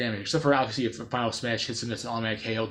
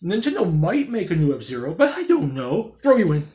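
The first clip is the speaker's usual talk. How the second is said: higher, louder, lightly mocked game director musing; Sakurai impression; 5 semitones higher, 6 dB louder than usual. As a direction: shrug it off like a producer deciding on a whim. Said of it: "Sakurai's like".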